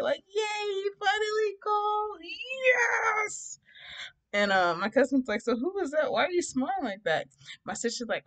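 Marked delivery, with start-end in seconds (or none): 0.3-3.6 very high, drawn-out mock squeal; super excited. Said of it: "But I'm super excited". 5.4-7.3 high-pitched imitation of nosy questioning; prying cousin. Cousin quizzes her, retold.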